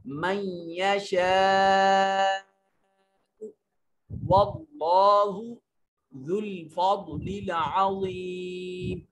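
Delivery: slow melodic tajweed chant; Quran recitation. Shown as a demonstration. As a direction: recite the verse slowly, drawing out each vowel. (धीमी सुरीली तिलावत)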